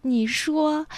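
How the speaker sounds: normal talking voice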